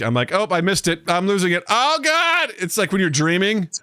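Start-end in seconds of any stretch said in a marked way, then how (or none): 0.3-2.5 mock panic climbing in pitch; losing confidence. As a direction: start worried, end on a high wail. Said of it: "I lose confidence as I'm saying it".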